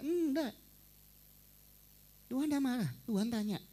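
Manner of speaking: normal talking voice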